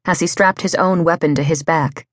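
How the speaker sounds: plain reading voice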